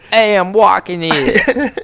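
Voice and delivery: loud, slow New York street tough; New York accent. Retold or imitated. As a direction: bark it like a New York cabbie. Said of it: "That's my impression of New York accent".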